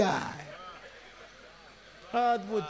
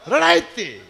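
laughing retort; 4 semitones higher, 8 dB louder, slower than usual. Real, not acted. Mocking the song's advice.

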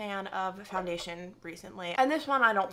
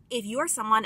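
ordinary talk

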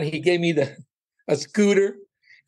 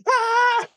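a shocked cry of alarm; panicked yelp. Retold or imitated. Realizing the appointment is in an hour, high startled yelp. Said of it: "I'm like".

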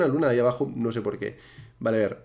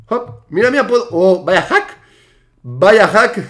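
loud and laughing in amazement; thrilled, louder on the repeat; amazed exclamation; 5 semitones higher, 7 dB louder, slower than usual. Real, not acted. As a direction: hoot at an unbelievable trick. Amazed at his own trick.

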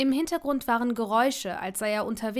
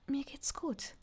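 soft, hushed reply; a soft whisper, hiding her nerves; 3 semitones higher, 10 dB quieter, slower than usual. Acted. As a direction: barely breathe it into the phone. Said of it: "flüsterte Hermine".

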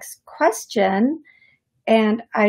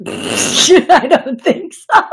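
nervous laughter spilling through the words, higher and louder; laughing; 6 semitones higher, 7 dB louder, much slower than usual. Real, not acted. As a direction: giggle it out, can't hold it back. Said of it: "I laugh when I get nervous".

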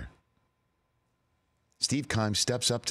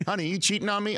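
higher-pitched suspicious question; mock partner voice; 11 semitones higher, faster than usual. Host voicing a suspicious partner, mock high question. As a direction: lightly play the suspicious partner.

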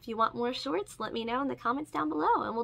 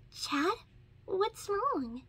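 higher, worried question; concerned; 9 semitones higher, much slower than usual. Acted. Ladybug to hurt Chat, a mildly concerned high question. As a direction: ask with a touch of concern. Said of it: "Ladybug asked, concerned".

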